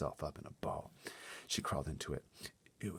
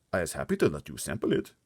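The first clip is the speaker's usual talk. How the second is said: lightly voiced friendly giant, louder; a gentle giant offering politely, a touch louder; hint of the big friendly giant making an offer; BFG's voice; 7 dB louder than usual. A narrator's reading. BFG voiced in read story.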